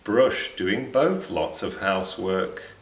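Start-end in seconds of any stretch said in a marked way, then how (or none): none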